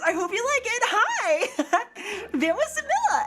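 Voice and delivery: very high cutesy greeting; high-pitched kawaii character voice; kawaii VTuber voice. Shown as a demonstration. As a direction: gush it out like a tiny anime idol. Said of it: "this is my real voice".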